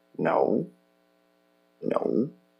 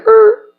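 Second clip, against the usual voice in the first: punched grunt; 11 dB louder than usual. Retold or imitated. Getting punched in fight story.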